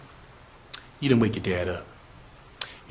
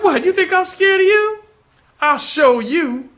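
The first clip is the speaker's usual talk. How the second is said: much higher, louder taunt; scornful challenge; 4 dB louder than usual. Acted. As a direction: Darnell taunting the Creole woman, high and loud. Darnell mocks her, louder.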